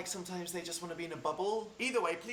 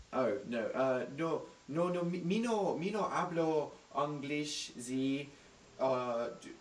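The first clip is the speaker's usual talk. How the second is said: fake Spanish accent; slower than usual. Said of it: "pretended to not speak English".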